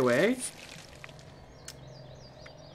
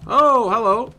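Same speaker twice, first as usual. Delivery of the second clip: surprised; 5 semitones higher, 8 dB louder than usual. Real, not acted. Suddenly sees a creature.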